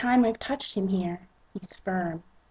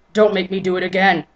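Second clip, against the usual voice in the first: loud quick warning; 7 dB louder, faster than usual. Acted. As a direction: snap the threat fast. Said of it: "he warns".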